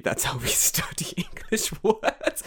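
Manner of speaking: slow, half-whispered laughing line; laughing through it. Real, not acted. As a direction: giggle through the words.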